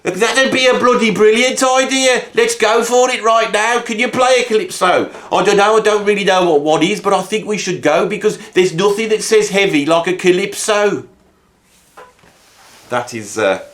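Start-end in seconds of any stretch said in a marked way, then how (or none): 0.0-11.1 high, excited put-on bandmate voice; imagined band member. Teen's imagined band members daydreaming aloud, high excited voice. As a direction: pitch it up like a giddy rocker pitching an idea.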